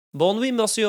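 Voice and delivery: hammed-up mock French; playing at fluent French; fake French accent. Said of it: "a much better fake French accent".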